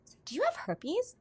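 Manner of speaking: high, rising, out of the blue; asking a date out of nowhere whether he has herpes; 1 blurted question. Retold or imitated. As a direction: ask it casually though it's shocking. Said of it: "I was like".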